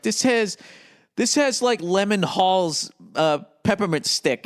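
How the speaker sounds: lightly mock a hipster server; pretentious server. Mocking a hipster ice cream server listing flavors. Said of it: "it's just like".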